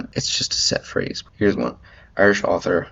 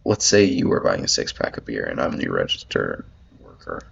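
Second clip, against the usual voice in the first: ordinary talk, nothing marked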